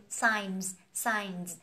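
"Signs" with a final z sound, falling; plural signs. Shown as a demonstration. Teacher modelling the plural for learners. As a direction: end "signs" on a buzzing z. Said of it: "có số nhiều một chút xíu".

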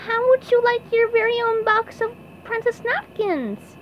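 very high cartoon offer; SpongeBob voice. A narrator's reading. SpongeBob presenting napkins to princess, high-voiced offer.

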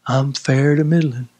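low, soft-edged, listless answer; acting faint. Preacher acts out a dry believer's reply to "how you doing", faint. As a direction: answer as if you've got little left. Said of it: "I will act faint".

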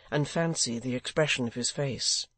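ordinary delivery

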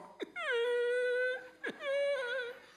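high, loud mock crying wail. Preacher mocking a wife who cries instead of talking, mock wail. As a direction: wail like a weeping wife, hammed-up. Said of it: "mocking a crying person".

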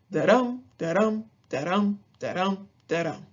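"da-DUM" five times, second syllable stressed; iambic rhythm demo. Shown as a demonstration. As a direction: beat out "da-DUM" evenly, stressing each DUM. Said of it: "The rhythm is unstressed, stressed".

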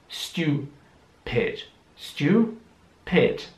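"Stupid" split into "stu", pause, "pid"; syllable by syllable. Shown as a demonstration. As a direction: break "Stupid" into two separate syllables. Teacher breaks word into syllables.

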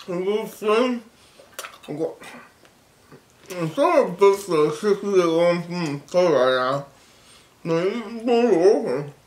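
cheeks stuffed, lips pushed out; talking around a full mouth; mouth full of gumballs. Real, not acted. Seen 5: Cheeks bulge, pink gumballs visible between lips throughout the words.

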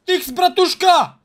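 loud, high pleading calls; urgent shout. Pretend. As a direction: demand the sticks urgently. Begging partner for sticks.

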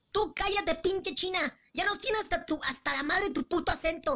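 high, squeaky insult spat out; high squeaky fight voice. Acted. Girl insults the classmate's accent, high squeaky fight voice. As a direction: squeal the insults like a cartoon brat.

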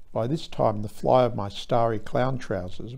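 ordinary delivery

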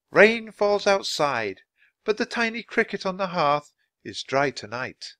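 poem recital. Acted. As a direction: recite it gently, savouring each image. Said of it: "She recited".

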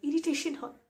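loud, exasperated complaint; irritated outburst. Acted. Fed up with Rupali repeating the same phrase, loud and irritated. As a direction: burst out loudly in irritation.

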